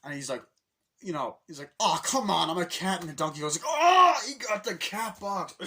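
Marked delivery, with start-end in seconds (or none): 1.8-3.0 higher, loud complaint; put-on indignant moan; mock protest. Acting Mario protesting his costume. 3.7-5.5 high, loud, drawn-out jeer; hammed-up Donkey Kong taunt; mocking shout. Donkey Kong mocking Mario's cat suit.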